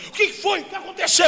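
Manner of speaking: high, bewildered voice; confused sister. Retold or imitated. The freed sister waking, asking what happened, confused. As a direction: wake up dazed and puzzled.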